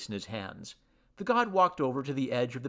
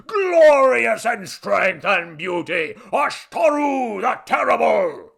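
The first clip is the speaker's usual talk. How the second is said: loud, high oratory building to a roar; ranting grandiose god; 7 semitones higher, 11 dB louder than usual. Acted. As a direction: thunder out titles, climbing to a slow climax. God's boast builds to climax.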